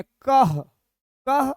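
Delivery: कह with a breathy h release after क; visarga sound. Shown as a demonstration. Teacher shows students how to read क with visarga. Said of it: "तो क्या पढ़ेंगे?".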